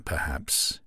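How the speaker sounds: plain reading voice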